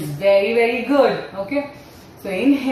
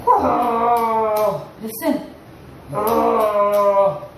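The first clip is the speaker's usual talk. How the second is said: mock awe; much slower than usual. Discovering crocodile in story.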